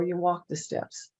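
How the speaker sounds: usual speaking voice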